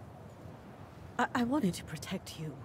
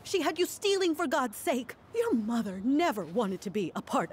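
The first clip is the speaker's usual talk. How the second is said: heated defence; 7 semitones higher, 4 dB louder than usual. Acted. Aunt defends herself heatedly.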